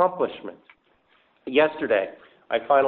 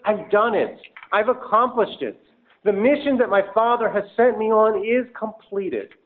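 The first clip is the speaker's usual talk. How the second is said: triumphant declaration; 7 semitones higher, 4 dB louder than usual. Retold or imitated. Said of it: "No, he means".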